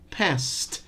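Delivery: ordinary talk, nothing marked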